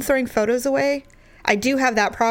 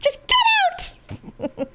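very high exasperated shriek; mock yell; much slower than usual. Wife repeating her order to him, mock shriek. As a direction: screech it to drive someone away. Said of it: "I'm like".

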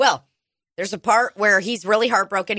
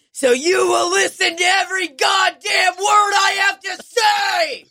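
rising to a much higher shout; angry shout; 10 semitones higher than usual. Retold or imitated. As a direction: seize the mic and yell. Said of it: "He's like".

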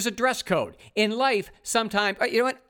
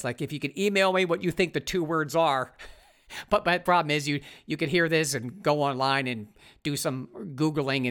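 usual speaking voice

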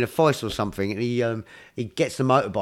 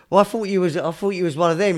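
put-on higher, louder old dad voice; imitating the dad; 6 semitones higher, 5 dB louder than usual. Dad explaining himself to Indy, higher mock voice. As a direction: play the flustered dad, pitched up. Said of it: "and he goes".

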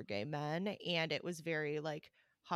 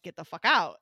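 yelled kick-out order; shouting; 13 dB louder than usual. Retold or imitated. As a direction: bark it like a bouncer. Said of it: "they'd be like".

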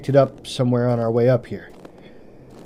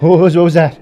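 sudden loud, higher cry of alarm; startled shout; 6 semitones higher, 11 dB louder than usual. Acted. Alone in dark woods hearing something, startled shout. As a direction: jump at the noise and yell it out.

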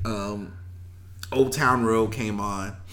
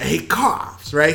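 ordinary delivery

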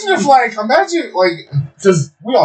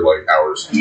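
usual speaking voice

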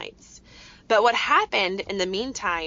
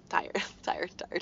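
whispered, drowsy tired; mother whispering how tired her son was; sleepy whisper; 7 dB quieter than usual. Retold or imitated. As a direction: breathe the words like a worn-out kid. Mimicking sleepy child at night.

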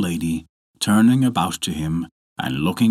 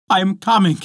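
high, louder reply; calling back; 11 semitones higher, 5 dB louder, faster than usual. A narrator's reading. Wife answering her husband below, calling back. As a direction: call back urgently, pitched up.

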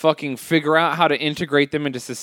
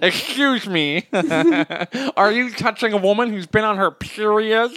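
God Squad impression; 3 semitones higher than usual. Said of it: "my impression of all three groups".

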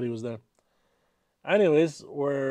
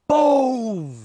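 crash noise; 10 semitones higher, 7 dB louder than usual. Retold or imitated. Car crash retold.

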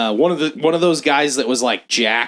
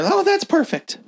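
put-on high, pleased old lady voice; mock satisfied old lady. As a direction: sound finally satisfied in a fussy high voice. Picky customer finally satisfied.

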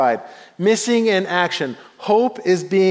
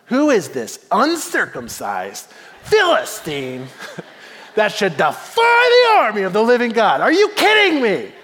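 offended outrage; 3 semitones higher than usual. Acted. Offended David to the soldiers about the Philistine, mock outrage. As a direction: build to an incredulous, mock-outraged outburst. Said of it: "David is offended".

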